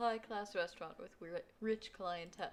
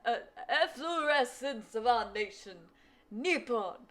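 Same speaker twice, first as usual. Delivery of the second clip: put-on loud, high rambling old gentleman; old man voice; 4 semitones higher, 13 dB louder than usual. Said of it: "I only have one old man voice".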